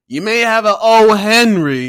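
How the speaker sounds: ordinary talk, nothing marked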